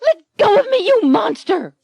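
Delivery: high, loud, crying scream; heroine screaming. Acted. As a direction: shriek while struggling free.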